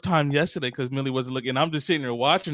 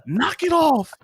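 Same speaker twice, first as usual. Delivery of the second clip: raised voice, higher, telling Millie off; scolding the dog; 8 semitones higher, slower than usual. Retold or imitated. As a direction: snap at the dog to stop. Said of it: "I'm like".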